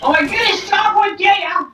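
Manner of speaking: high excited gasp of a name; starstruck squeal. Real, not acted. Meeting first celebrity.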